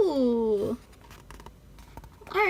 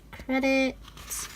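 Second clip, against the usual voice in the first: ordinary delivery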